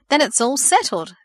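joyful. Acted. Aunt settles the move, joyful. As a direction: clap it out with joy. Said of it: "clapped her aunt joyfully".

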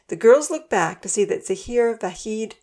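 ordinary delivery, nothing marked

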